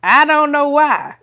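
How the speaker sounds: high, loud exclamation through laughter; laughing exasperation. Real, not acted. Puzzling aloud over God's last-minute timing, laughing exasperation.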